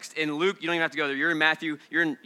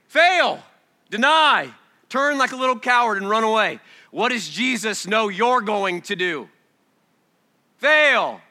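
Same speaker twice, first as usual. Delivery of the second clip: loud, high, fervent preaching; shouting the hard truth; full-force preaching; 7 semitones higher, 6 dB louder than usual. Real, not acted. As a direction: hammer each word home.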